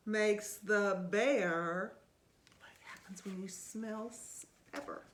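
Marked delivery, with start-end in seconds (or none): 2.6-5.0 whispering. Real, not acted. Aside question to child.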